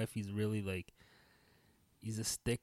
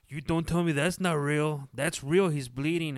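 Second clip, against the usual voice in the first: put-on loud, higher-pitched boyhood protest; raised voice, arguing it out; retold heated protest; 6 semitones higher, 8 dB louder than usual. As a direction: imitate yourself as a kid insisting, loudly.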